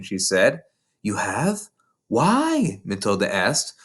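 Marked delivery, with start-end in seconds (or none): 1.0-2.8 put-on lightly raised little girl voice; Matilda's voice. A narrator's reading. Narrator voicing young Matilda to her teacher, small girl voice. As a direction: voice a bright, earnest young girl.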